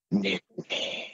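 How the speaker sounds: quiet, airy ghost reply; ghost whisper. Retold or imitated. Ghost answering the man's question on the recorder, whispered. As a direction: let the word drift out like a faint voice on tape.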